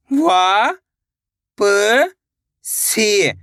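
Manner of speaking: isolated, held sounds with long pauses; teacher slowly spelling out by sound; syllable by syllable. Shown as a demonstration. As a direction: sound out each syllable separately for learners. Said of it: "एक अक्षर का उच्चारण करके".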